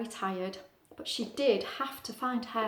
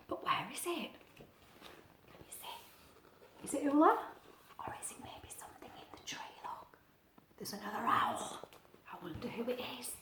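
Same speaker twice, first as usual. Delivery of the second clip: whisper; 12 dB quieter than usual. Real, not acted. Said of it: "whispered asides".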